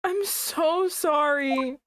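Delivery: high, slow, weepy sorry; tearful apology. Real, not acted. Player apologising tearfully to the game character, weepy.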